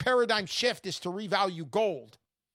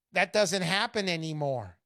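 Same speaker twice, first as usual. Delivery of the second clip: ordinary delivery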